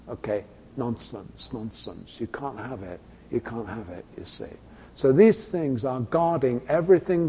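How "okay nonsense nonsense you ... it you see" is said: much softer, lower, firm; quiet dismissal. Real, not acted. Preacher rejecting the demands, quiet and firm. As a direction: wave it away under your breath.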